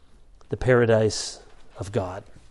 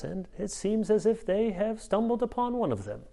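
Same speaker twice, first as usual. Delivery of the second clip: a hint of an elderly scholar, pitched up and soft; lightly voiced J I Packer; 4 semitones higher, 6 dB quieter than usual. Preacher recalls Packer's reply about his book, lightly mimicked. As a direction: muse on it with dry, eloquent understatement. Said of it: "I won't try to do his, his British accent".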